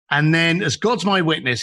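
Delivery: usual speaking voice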